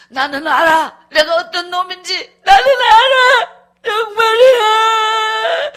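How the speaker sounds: loud cry climbing to a long wail; imitated sobbing wail. Husband crying alone over his sins, loud drawn-out wail. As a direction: bawl it out, drawing out the last word. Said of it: "자기 혼자 막 그렇게 울더라고요".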